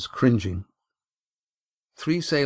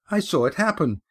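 put-on higher young girl, indignant; girl denouncing the boy to the captain, higher; accusing girl; 7 semitones higher than usual. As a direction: spill it all out like an angry teenager.

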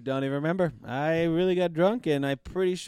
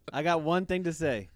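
ordinary talk, nothing marked

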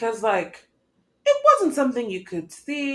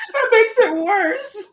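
high, slow, through laughter; laughing; 10 semitones higher, 7 dB louder, slower than usual. Real, not acted. Cohost cracking up at the sibling joke. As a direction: squeal it mid-laugh.

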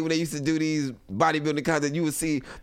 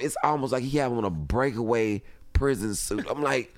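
usual speaking voice

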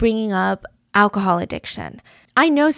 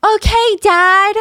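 whiny imitation; 9 semitones higher, 4 dB louder than usual. Imitating Jamie's self-pitying rant.

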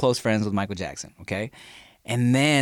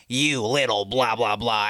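lightly voiced slow grouch; a hint of a put-on character voice, drawn out. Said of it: "that guy's voice is like".

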